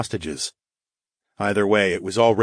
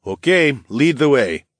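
higher, louder companion voice; agreeing companion; 6 semitones higher, 5 dB louder than usual. A narrator's reading. Noble agreeing to follow the hero, higher and louder. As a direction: agree readily, pitched up and louder. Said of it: "Nike agreed".

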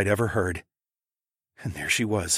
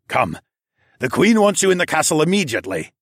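hurried, raised urgent summons; 7 semitones higher, 5 dB louder than usual. A narrator's reading. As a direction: rush him to the castle. River urgently summons Evan.

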